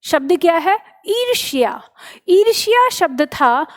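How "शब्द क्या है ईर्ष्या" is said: ordinary talk, nothing marked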